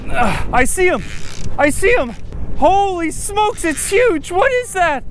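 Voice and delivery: high, loud, awestruck exclamations; stretched-out, high-pitched thrilled yelling; real excitement. Real, not acted. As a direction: cry out in amazement at a huge catch. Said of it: "I'm a little excited right now".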